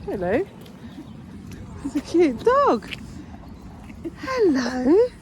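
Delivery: high-pitched, drawn-out gushing over a cute pet; sing-song doggy-greeting voice; cooing at a dog. Real, not acted. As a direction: melt over an adorable little dog.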